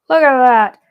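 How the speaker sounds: loud, thrilled outburst; excited exclamation. Real, not acted. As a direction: belt it out in amazement. Delighted repeat as the piece works.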